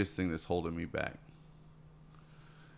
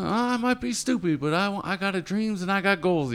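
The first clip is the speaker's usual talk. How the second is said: much higher, earnest mock plea; imitating a determined young dreamer; defiant young Les Brown; 10 semitones higher, 4 dB louder than usual. Imitating Les Brown answering the boss, higher and earnest. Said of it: "he says".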